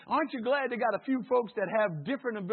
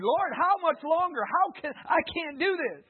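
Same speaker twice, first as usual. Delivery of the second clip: impassioned preaching; 10 semitones higher, 9 dB louder than usual. Acted. Preaching at full force.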